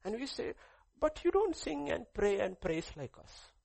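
loud, high, impassioned preaching. Acted. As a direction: preach it out over the whole room. Preaching at full force.

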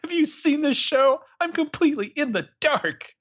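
hammed-up dramatic heroine; imitating Taylor.

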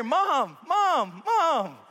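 put-on high, insistent child calling mom; whiny kid. Child in the car calling mom, insistent and high. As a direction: nag like a kid in the back seat. Child nagging from back seat.

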